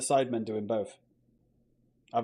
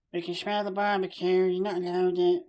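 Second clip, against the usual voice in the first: whiny neighbour; 6 semitones higher, 4 dB louder than usual. Retold or imitated. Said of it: "complain about the fucking weed".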